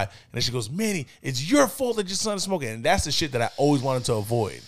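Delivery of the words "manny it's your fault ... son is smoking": high-pitched scolding; blaming mom. Retold or imitated. Mom blames dad for son's smoking, angry. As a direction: imitate a wife laying into her husband.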